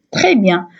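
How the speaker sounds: normal talking voice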